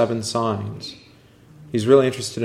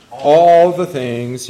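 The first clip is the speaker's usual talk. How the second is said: all stretched, slow and louder; emphatic answer; 4 semitones higher, 8 dB louder, much slower than usual. Acted. Teacher corrects the class's guess, slow emphatic stress. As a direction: lean hard on all.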